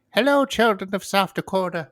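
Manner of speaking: high lilting mock Irish voice; leprechaun. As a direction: greet the children like a leprechaun. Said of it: "She sounded like a, leprechaun".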